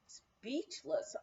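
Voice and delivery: ordinary delivery, nothing marked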